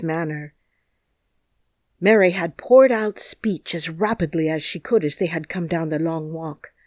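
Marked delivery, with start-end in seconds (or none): none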